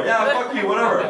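ordinary talk, nothing marked

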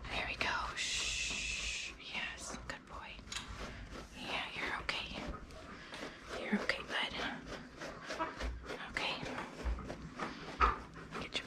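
soft whispered calming; soothing whisper. Real, not acted. Owner whispering softly to calm her dog at the end of grooming. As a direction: whisper gently to settle him.

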